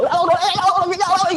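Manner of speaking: high, loud strained oh oh oh cries; mock movie panic cries. Describing a crash scene from movies, high panicked cries. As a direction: cry out in a high panicked wail like a film actor. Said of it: "those movies where you see the person just go".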